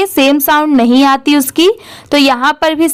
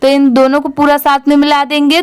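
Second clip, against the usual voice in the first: normal talking voice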